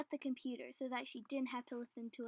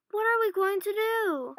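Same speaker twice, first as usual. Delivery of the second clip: high, loud and slow, panicked; frightened cry; 8 semitones higher, 10 dB louder, slower than usual. Acted. Trapped girl asks her friend, frightened cry. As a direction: despair at being trapped. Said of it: "Elizabeth cried".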